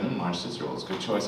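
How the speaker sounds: creepy clerk. Retold or imitated. Candy clerk to narrator's daughter, soft low creepy murmur.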